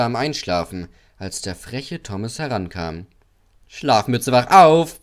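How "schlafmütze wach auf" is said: loud, rising wake-up call; whistled shout. A narrator's reading. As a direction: pipe up loudly to rouse a sleeper. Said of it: "pfiff er".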